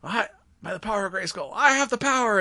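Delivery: gloat, building to a triumphant cry; Skeletor voice. Retold or imitated. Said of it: "Skeletor takes the sword from him".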